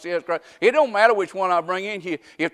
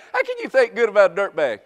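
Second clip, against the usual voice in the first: normal talking voice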